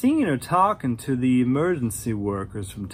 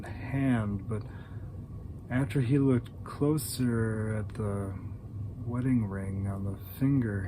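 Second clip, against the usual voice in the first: dry monotone; 5 semitones lower, 6 dB quieter than usual. A narrator's reading. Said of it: "she had this really dry monotone".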